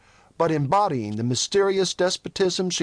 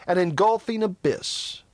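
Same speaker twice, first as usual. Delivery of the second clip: usual speaking voice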